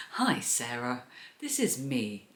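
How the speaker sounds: low, soft send-up of a presenter; mock podcasting voice. Vlogger parodying her own voice note to a friend, mock podcasting voice. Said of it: "with her podcasting voice".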